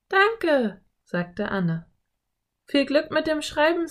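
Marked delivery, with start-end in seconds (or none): 0.1-0.8 put-on high, louder girlish thanks; delighted girl. A narrator's reading. Narrator voices Anne thanking Slim. 2.6-3.9 put-on high-pitched girl character; eager girl. A narrator's reading. Narrator voices the girl Anne.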